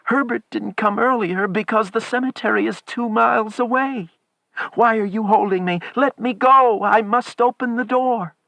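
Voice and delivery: very high, loud, desperate demand; frantic pleading. A narrator's reading. Wife begs husband to let her go, frantic shouting. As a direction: plead loudly, struggling to get loose. Wife struggles to free herself.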